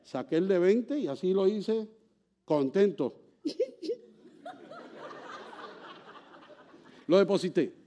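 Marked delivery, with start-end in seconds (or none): 4.4-6.2 a high airy laugh with no voice; real breathy laugh. Real, not acted. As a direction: let a soft laugh slip out at your own story. Laughing at his own story. (risa aireada y aguda)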